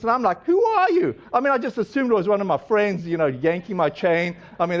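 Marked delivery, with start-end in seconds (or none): 0.4-1.1 high, incredulous retold question; disbelieving challenge with pitch shooting up. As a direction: act out doubting a prank caller. Retold disbelief at suspected prank.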